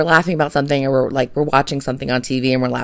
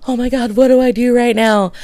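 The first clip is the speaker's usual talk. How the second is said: panicked husband; 9 semitones higher than usual. Retold or imitated. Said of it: "He looks like".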